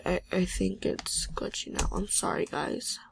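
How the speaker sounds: soft whispered apology, fading out; defeated whisper. Real, not acted. Player whispers an apology to viewers as the game glitches. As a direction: give up under your breath.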